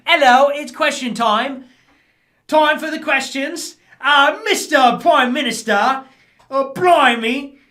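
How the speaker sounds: high, loud, hammed-up British accent, slowing at the end; mock British accent. Streamer mocking British question time in a British accent. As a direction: parody a dithering British MP. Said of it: "British politics is like".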